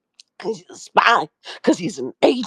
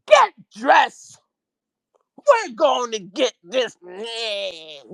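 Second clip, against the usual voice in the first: angry command; 4 semitones higher, much slower than usual. Retold or imitated. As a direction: order her to get dressed. Angry order at dawn.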